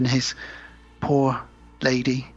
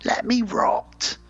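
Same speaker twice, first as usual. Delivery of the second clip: high, hammed-up complaint; exasperated bride; 8 semitones higher than usual. Bride to husband who raised her from dead, mock exasperated. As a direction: wail it as the fed-up bride, pitched way up. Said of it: "And she's like".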